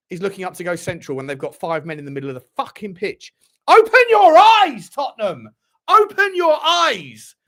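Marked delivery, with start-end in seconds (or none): 3.7-5.5 loud, high, slow yell; furious shout. Real, not acted. Yelling at team. 5.9-7.3 loud, high, slow yell; furious shout. Real, not acted. Yelling at team again.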